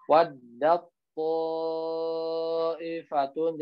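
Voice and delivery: very slow drawn-out waddat ta'ifatun; tajwid recitation. Shown as a demonstration. Teacher reciting the example for students, slow chant. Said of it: "Jadi bukan waddat".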